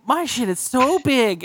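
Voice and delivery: high, slow imitation of a little girl; little kid. Kid announcing to the grown-ups, high drawn-out child voice. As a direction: draw it out as a proud small child. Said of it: "she would shit and be like".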